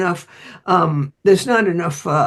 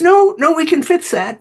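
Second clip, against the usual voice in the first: eager reassurance; 8 semitones higher than usual. Retold or imitated. Grid operator hurries to keep the plant, eagerly. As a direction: jump in to stop them leaving.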